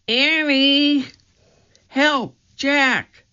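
trapped sister crying out for help; frightened cry for help. A narrator's reading. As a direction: scream from far away in fear. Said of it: "came a cry".

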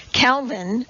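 ordinary delivery

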